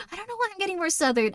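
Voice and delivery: amused, slightly Southern twang; Southern accent. Real, not acted. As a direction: slip into a light Southern accent. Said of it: "I think I've used that accent for too long".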